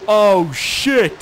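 loud, high cry of alarm; alarmed shout. Real, not acted. Player caught by a sudden storm, alarmed high shout. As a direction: yell it, startled by disaster.

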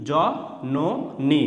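জ, ন, নি as three separate beats; letter by letter. Shown as a demonstration. Teacher sounding out letters for learners, জ, ন, নি spaced apart. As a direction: pause after জ and after ন, then নি. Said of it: "সেইভাবে অক্ষর উচ্চারণ করে".

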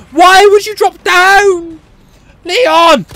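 slow, high wailing complaint; exasperated shout. Real, not acted. Player scolding Leon for dropping down, exasperated shout. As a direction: wail the question, then shout the name.

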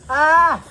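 pained burning cry. Real, not acted. Chili burns mouth.

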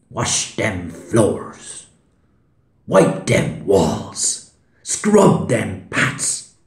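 loud, slow, rising commands; stern nun shouting chores, drawn out; barked orders. Acted. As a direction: order the child about, each command harder. Nun ordering child to work.

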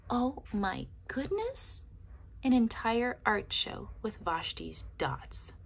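ordinary delivery

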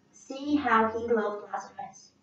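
plain reading voice

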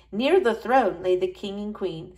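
ordinary delivery, nothing marked

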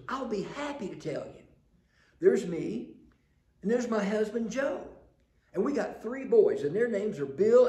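high, loud, eager chatty lady. Retold or imitated. Preacher voicing a chatty lady telling the census man her family. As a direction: rattle off the family, bright and eager.

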